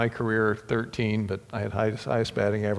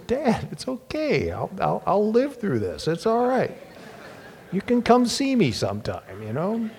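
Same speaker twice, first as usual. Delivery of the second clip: tearful, quoting his son's comfort; a father near tears repeating his son; choked up; 9 semitones higher than usual. Real, not acted. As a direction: voice breaking high while quoting the son.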